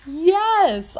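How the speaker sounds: high, drawn-out retold squeal of acceptance; happy yes. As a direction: say it high, overcome with joy. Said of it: "I was so happy".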